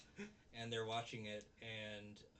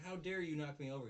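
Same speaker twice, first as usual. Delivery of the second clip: higher, louder indignant imitation; mock blaming; 5 semitones higher, 5 dB louder, faster than usual. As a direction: accuse him in mock outrage. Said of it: "blaming him".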